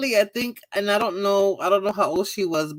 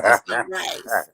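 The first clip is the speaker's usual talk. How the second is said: low, slow, dragged-out judgement; slow disgusted verdict; 8 semitones lower, much slower than usual. Retold or imitated. Judging the niece's rice at Thanksgiving, slow low verdict. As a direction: lay it down slow and heavy.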